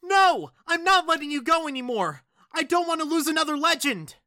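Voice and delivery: very high, loud, fast wail; desperate refusal. Acted. Clinging friend refuses to let go.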